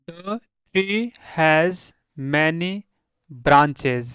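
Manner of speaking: sentence read word by word, very slowly; slow reading aloud. Shown as a demonstration. Teacher reading an example sentence to students, slow. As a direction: read it slowly so learners follow.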